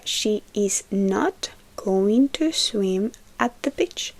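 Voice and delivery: slow, careful English example; slow model sentence. Shown as a demonstration. Teacher reading the example slowly to students. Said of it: "Entonces sería".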